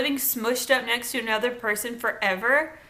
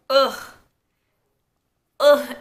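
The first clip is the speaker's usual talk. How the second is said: loud disgusted ugh; exasperated groan; 3 semitones higher, 7 dB louder than usual. Real, not acted. Groaning loudly at the scene she's recapping.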